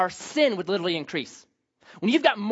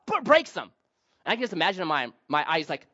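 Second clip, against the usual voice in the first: ordinary talk, nothing marked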